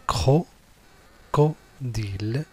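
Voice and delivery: crocodile slowly broken into cro, co, dile; syllable by syllable. Shown as a demonstration. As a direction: say cro-, co-dile with clear gaps between syllables. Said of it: "les mots avec les syllabes".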